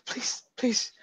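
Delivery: desperate breathless whisper; whispered pleading. Acted. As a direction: beg in a frightened whisper. Captive begging her torturer.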